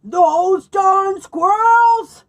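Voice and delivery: very slow, loud, high shout with the words drawn out; angry old man yell. Acted. Old man yelling at squirrels who emptied the feeders, loud and slow. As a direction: bellow it even bigger. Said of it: "Old Man Fookwire yelled".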